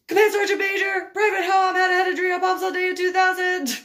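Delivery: high, loud, rushed military report; urgent shouted report. Retold or imitated. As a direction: bark it out like a panicked corporal. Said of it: "Corporal Watson runs over and he's like".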